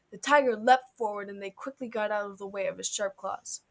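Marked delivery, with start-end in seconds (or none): none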